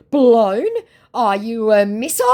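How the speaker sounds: high, loud incredulous questions; mock bafflement. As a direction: imitate a scammer taken aback. Acts out scammer's baffled retort.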